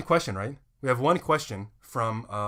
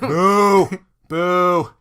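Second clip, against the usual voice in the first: long, loud, high jeer; mock booing; 7 semitones higher, 13 dB louder than usual. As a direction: heckle playfully at full volume. Mock heckling at imagined funeral.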